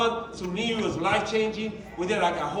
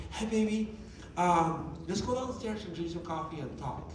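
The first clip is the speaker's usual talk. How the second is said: much softer gentle invitation; soft imitated wife; 3 semitones lower, 9 dB quieter than usual. Wife inviting husband to Sunday coffee, very soft. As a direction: coax him gently, very soft. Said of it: "She will wake up".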